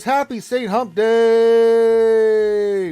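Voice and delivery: high, loud and very slow, stretched out; drawn-out greeting. Acted. Voicing a viewer's festive greeting, very slow and loud. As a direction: drag every word out, sing-song.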